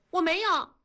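high-pitched defiant outburst; loud denial. A narrator's reading. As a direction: deny it loudly as an indignant daughter. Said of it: "燕妮大声地说". (大声顶嘴)

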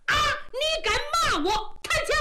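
high, furious cry of a general; angry outburst. Acted. Insulted general yelling as he charges, furious. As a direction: rear up in outrage and strike.

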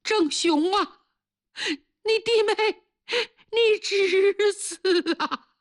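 put-on high, pleading old mother's voice, drawn out at the end; an old mother begging her son, high and slow; old mother pleads with her son, high and anxious. A narrator's reading. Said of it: "老母亲听这话着急了".

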